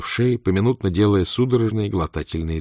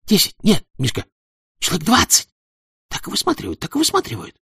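put-on thin eager boy; 10 semitones higher than usual.

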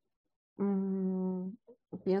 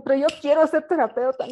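higher, much louder and fast, insisting; pleading protest; 7 semitones higher, 8 dB louder, faster than usual. Retold or imitated. Ingrid begging the interviewer to let her in, loud and fast. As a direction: plead your case in a rush. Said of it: "Le digo".

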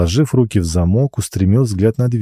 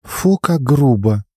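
slow, high, mock-offended girl; drawn-out pouty disgust; bossy little princess; 6 semitones higher, slower than usual. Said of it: "Алина сморщила носик".

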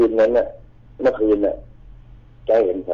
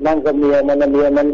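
agitated insisting; 7 semitones higher than usual. Retold or imitated. Uncle arguing, pointing across.

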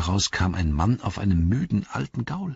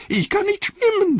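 very high, panicked squeal; Wormtongue terrified. Acted. Wormtongue at the water's edge squeals in very high panic. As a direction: shrink back from the water in terror. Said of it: "sagte er".

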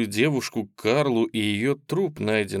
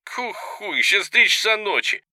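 groggy Ben; 10 semitones higher, 5 dB louder than usual. Acted.

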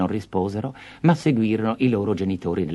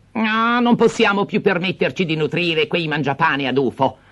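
higher, sharp stepmother snapping; harsh outburst; 8 semitones higher than usual. Acted. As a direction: spit it out with contempt. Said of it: "sbottò lei aspramente".

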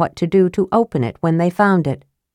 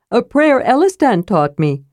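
higher-pitched character voice; proudly earnest, pitched up; earnest sidekick; 5 semitones higher than usual. A narrator's reading. Tasselhoff's voice continues.

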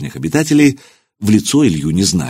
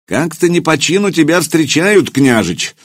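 a lightly raised voice over the noise; 7 semitones higher than usual. Acted. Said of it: "повысив голос".

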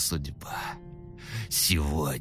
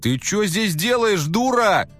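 angry yell; 12 semitones higher, 7 dB louder than usual. Acted. Man yelling at woman.

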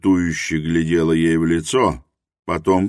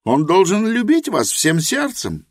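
Helena's voice; 13 semitones higher than usual. A narrator's reading. Helena admits her ignorance to Rosa, high and earnest. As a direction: confess humbly in Helena's high voice.

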